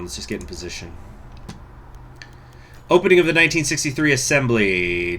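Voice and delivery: ordinary talk, nothing marked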